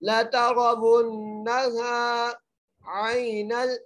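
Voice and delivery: very slow chant, thick ra in तरवुन्नल; nasal ghunna on the doubled nun; heavy ra. Shown as a demonstration. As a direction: recite ला तरवुन्नल जहीम melodically. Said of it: "एक अलिफ की मकदार खींच".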